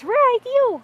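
high sing-song praise. Real, not acted. Mother praises baby.

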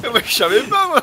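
bursting out laughing while talking; loud laughter. Real, not acted. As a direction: laugh hard through the words. Laughing after teammate's blunder.

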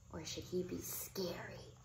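soft unvoiced whisper; hushed suspense. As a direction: whisper the choice. Dilemma suspense.

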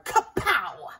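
loud punchy sound word; comic-book kapow. A narrator's reading. Narrator shouting a comic-book kapow.

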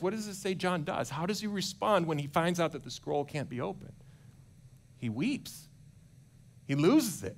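ordinary talk

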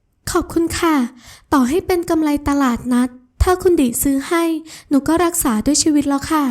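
put-on high, wheedling young woman's voice; coaxing sweet girl. Ramon wheedles Adisa to stop bidding, high and sugary. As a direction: sweet-talk her out of it, voice up high.

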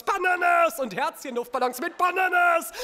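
loud, high, lovestruck yell; over-the-top euphoric outburst; ecstatic gushing. Acted. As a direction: belt it out in giddy rapture. Slam performer gushes about lovestruck vision.

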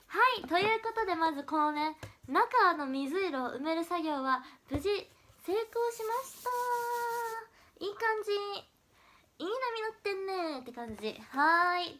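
high, singsong cheer with stretched endings; forced high spirits. Real, not acted. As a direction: announce a little success with put-on pep. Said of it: "いわゆる空元気ってやつ".

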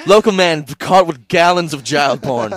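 loud, high mock headline announcer; mock news headline. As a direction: blare it like a breaking headline.

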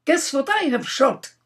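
put-on proud young hunter, high and loud; excited demand. Russian youth showing off his game bag, excited demand. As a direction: demand a guess, bursting with the news. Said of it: "he demanded".

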